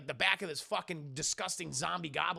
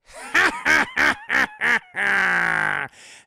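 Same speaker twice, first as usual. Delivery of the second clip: loud, spaced-out goblin cackle; big deliberate evil laugh building to a long tail; villain cackle; 13 dB louder than usual. Acted. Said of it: "he doesn't have the Willem Dafoe".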